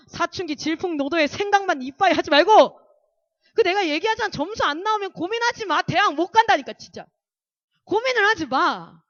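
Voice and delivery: 크게 쏟아내는 잔소리; 아이들을 붙잡고 힘주어 꾸짖듯; 진심 어린 다그침. Acted. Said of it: "이거는 진심으로 나왔다 방금". (진심으로 열 올려 훈계)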